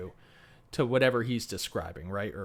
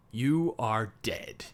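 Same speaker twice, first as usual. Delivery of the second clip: slow, low, quiet threat; contained rage; 4 semitones lower, 5 dB quieter, slower than usual. Retold or imitated. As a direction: promise vengeance slowly and softly. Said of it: "this primal rage builds up".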